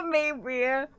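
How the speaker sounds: ordinary talk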